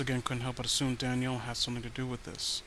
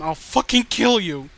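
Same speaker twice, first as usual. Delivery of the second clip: louder, higher snarling death threat; furious threat; 5 semitones higher, 10 dB louder than usual. Acted. Spurned girlfriend threatening Daniel, furious shout. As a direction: explode into a threat to kill.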